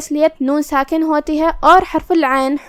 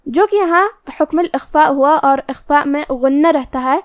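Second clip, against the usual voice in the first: normal talking voice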